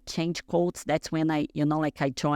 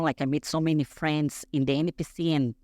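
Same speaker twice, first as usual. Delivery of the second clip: normal talking voice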